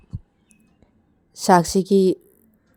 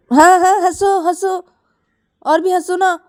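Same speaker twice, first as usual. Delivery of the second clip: high, louder mocking go on laugh; sarcastic; 12 semitones higher, 5 dB louder than usual. Girl with luggage snaps sarcastically at laughing friend. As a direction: bitterly dare her to keep laughing.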